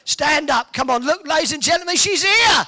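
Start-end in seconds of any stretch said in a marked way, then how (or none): 1.3-2.6 proud shout to the room; calling out. Real, not acted. Host announces to the audience that Betty is back, shouting. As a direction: announce her return up loud, pitched higher.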